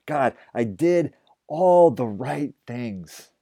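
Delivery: pleading. Acted. Elijah pleads with God, slow and anguished. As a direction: cry out that it isn't fair.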